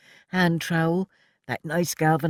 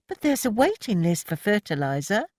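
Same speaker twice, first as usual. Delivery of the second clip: objecting; 7 semitones higher than usual. A narrator's reading. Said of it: "Annie objected".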